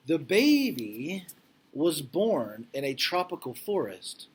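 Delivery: reading aloud to class. Acted. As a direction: read it out like a storybook for kids. Reads picture book to class.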